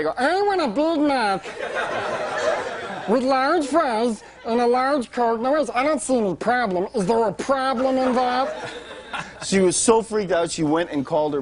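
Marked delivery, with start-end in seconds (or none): 0.2-8.7 high helium voice, slow and indignant; pretend mad. Comedian faking anger at the cashier in a high helium voice. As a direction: fake outrage in a squeaky high voice. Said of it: "So now I pretended like I got mad".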